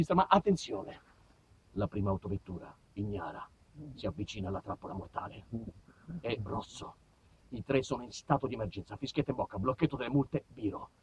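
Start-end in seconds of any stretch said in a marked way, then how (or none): 1.7-10.9 low, soft thriller narration; mock nature-documentary hush; hushed suspense. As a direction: build the tension in a near whisper.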